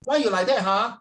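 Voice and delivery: high, loud Singlish complaint; overheard Singlish. Acted. Singaporean couple overheard in a London mall, high loud Singlish. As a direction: act a bickering Singlish couple. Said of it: "you heard a couple saying".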